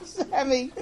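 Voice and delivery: high, laughter-squeezed call; father overcome with giggles; laughing. Acted. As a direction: gasp the name out between laughs. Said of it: "He started laughing".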